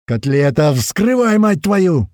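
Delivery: high, loud impatient order; yelling. A narrator's reading. Fighter yelling at the grenadier, high loud order. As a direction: yell at your mate to get it open. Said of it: "орёт ему Слива".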